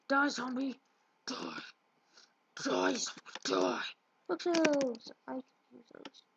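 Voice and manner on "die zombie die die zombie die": growled battle cry. Pretend. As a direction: growl it like a game monster. Yelling at zombie while attacking it.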